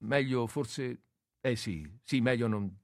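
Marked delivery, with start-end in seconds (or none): none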